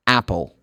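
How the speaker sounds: "Apple" with open American A; American pronunciation. Shown as a demonstration. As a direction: start "Apple" on the A-E mixed vowel, quickly.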